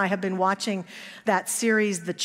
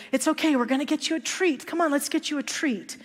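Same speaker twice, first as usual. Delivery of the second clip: put-on higher, quick coaxing to a crying child; soothing mom; 7 semitones higher than usual. Mother soothing her screaming little boy with a quick coaxing tone. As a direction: coax the little boy into calm with a treat.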